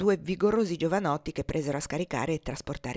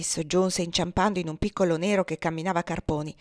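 usual speaking voice

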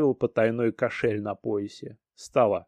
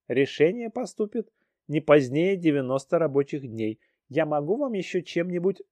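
high, prim customer-service voice; polite clerk voice; 7 semitones higher than usual. Acted. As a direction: recite it like a polite robot clerk. Hologram clerk recites rules.

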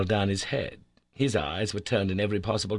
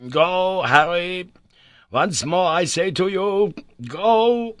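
loud, high, drawn-out command; terribly excited; 10 semitones higher, 8 dB louder, slower than usual. A narrator's reading. Professor ordering Harry to go, terribly excited. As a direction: cry it out high and long. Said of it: "was terribly excited".

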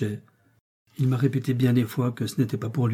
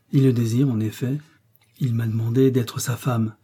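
ordinary delivery, nothing marked

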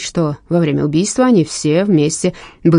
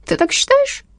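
hissed. Acted. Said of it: "прошипел убийца".

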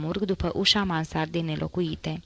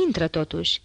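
usual speaking voice

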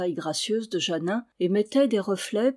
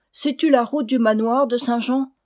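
a touch of a round, good-natured knight, a bit higher; jovial knight; 4 semitones higher, 6 dB louder than usual. Acted. Knight asks the frightened boy the way, jovial. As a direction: ask the boy kindly but from above. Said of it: "la voix du chevalier fût pleine de rondeur et de bonhomie".

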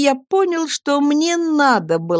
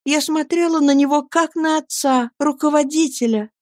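put-on a higher, earnest young wife's voice; Annie pleading; 7 semitones higher than usual. Young wife begging her friends to speak, pleading.

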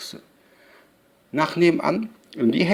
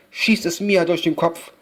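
ordinary delivery, nothing marked

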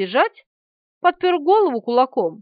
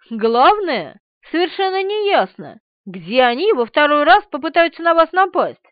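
louder, bright eager delivery; cheerfully; 4 semitones higher, 5 dB louder than usual. A narrator's reading. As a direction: gleefully pick up the thought. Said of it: "радостно подхватила её мысль Негодько".

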